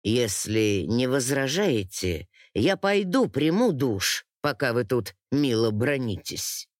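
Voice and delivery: low-pitched, courteous man; lord's voice. A narrator's reading. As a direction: excuse yourself in a low male voice. Lord excuses himself to bathe.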